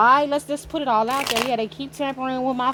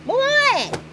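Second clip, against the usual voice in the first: exasperated shout; 14 semitones higher, 4 dB louder than usual. Real, not acted. Fed up with harassing energies.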